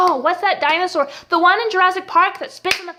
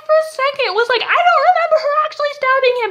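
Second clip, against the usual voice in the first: very high-pitched retold surprise; squeaky, incredulous rush of words; startled disbelief; 10 semitones higher, 4 dB louder than usual. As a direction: voice leaps up in mock alarm. Retells own surprise at stabbing.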